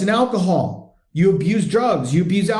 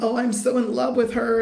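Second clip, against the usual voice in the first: higher, gooey put-on swooning; mock lovesick; 5 semitones higher, 3 dB quieter than usual. Coach mocking men blinded by love, mock lovesick. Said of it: "that ooey gooey love".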